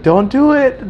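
high-pitched imitated shopkeeper call; retold cry of caution; urgent warning.

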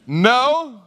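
high, loud, rising sassy no. Acted. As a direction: refuse with plenty of sass. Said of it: "Say it with some sass".

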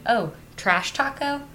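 usual speaking voice

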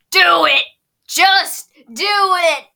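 slow, loud defiant shout. Retold or imitated. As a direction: dare him, dragging it out. Zhao voiced defiant.

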